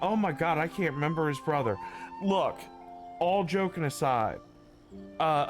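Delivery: usual speaking voice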